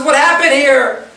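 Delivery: high-pitched startled demand; acted alarm. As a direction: burst out as the stunned brother. Esav acted, walking in shocked.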